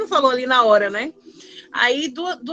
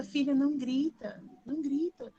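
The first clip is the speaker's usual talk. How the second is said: mother speaking softly; 9 dB quieter than usual. Retold or imitated. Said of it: "mais baixo ela falava".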